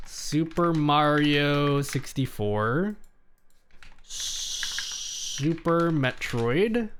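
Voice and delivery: normal talking voice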